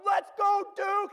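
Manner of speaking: high, full-voiced sports-fan cheer; excited shout. Fan cheering on Duke in the tournament, excited shout. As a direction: yell it at the game, loud and high. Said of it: "I'm shouting".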